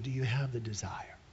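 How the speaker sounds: hushed whispered question. Shown as a demonstration. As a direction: whisper it like a secret plea. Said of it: "He was asking".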